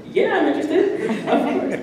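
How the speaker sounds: high, soft, laughing squeak; playfully incredulous, high-pitched self-imitation; mock eager reply. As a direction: squeal it softly as if it's obvious. Mock incredulous reply to phone offer.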